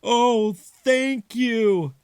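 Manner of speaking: high, slow, drawn-out thanks; grateful Father. Acted. Father thanking the mice for the rings, slow and grateful. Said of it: "said Father".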